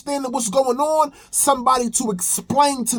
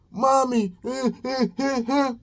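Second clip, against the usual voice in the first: mock whimpering sobs of a small boy, a little higher; imitated child crying; 3 semitones higher, 4 dB quieter than usual. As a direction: whine "Mommy" then fake little sobs. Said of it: "My sons will cry".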